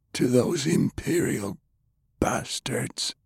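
put-on grim old commander. A narrator's reading. Pershing gives in, harsh.

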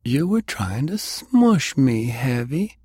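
high, drawn-out playful exclamation; exclaiming. A narrator's reading. Delilah to the big man who attacked her, exclaiming. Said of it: "she exclaimed, eyes twinkling".

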